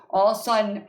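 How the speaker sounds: ordinary delivery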